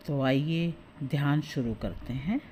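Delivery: soft meditation voice with long pauses; guided meditation. Acted. Teacher settling listeners into breathing practice, soft guided meditation. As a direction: ease listeners gently into stillness, phrase by phrase.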